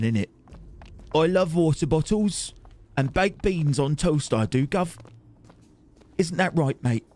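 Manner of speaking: hammed-up Cockney twang; Cockney London accent. Streamer parodying American attempts at British speech, mock Cockney accent. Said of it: "they always go for Cockney London twang".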